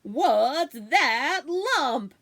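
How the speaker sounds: slow, loud, drawn-out theatrical boom. A narrator's reading. Parents booming at hidden kids, loud and theatrical. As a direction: boom it like a game-show host. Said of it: "saying in loud theatrical tones".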